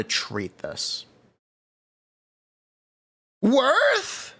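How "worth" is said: high squeaky question. Acted. Recording voice clips, rating.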